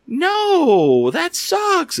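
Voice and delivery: high disappointed cry; dismayed fans. Retold or imitated. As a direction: squeal it like let-down fans. Fans react to her leaving.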